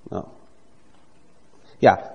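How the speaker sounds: ordinary talk, nothing marked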